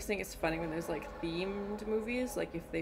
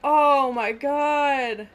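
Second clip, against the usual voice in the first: horrified; 7 semitones higher, 12 dB louder, much slower than usual. Real, not acted. Horrified reaction, drawn out.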